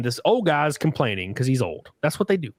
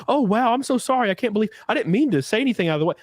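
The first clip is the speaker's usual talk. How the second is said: high, rushed apologizing; flustered, hammed-up backpedaling; mock profuse apology; 7 semitones higher, faster than usual. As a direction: imitate a customer gushing with apology. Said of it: "they profusely apologize".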